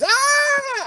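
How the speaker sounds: high, held squeal of excitement; mock frenzied scream. Girl going wild over dancers, mock frenzied scream. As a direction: scream like she's going bananas. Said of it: "she's just fucking going bananas".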